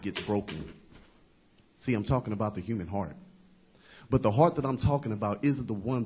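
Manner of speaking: rhythmic recital building in force; spoken-word poem. Acted. Poet tells a crowd about being heartbroken, spoken-word recital. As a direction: recite in driving phrases that settle at each close.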